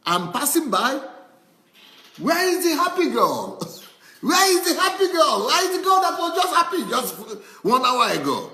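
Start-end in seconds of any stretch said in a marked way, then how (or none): 2.2-8.5 higher, louder teasing question, laughing; laughing mock search. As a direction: tease about the vanished happy girl.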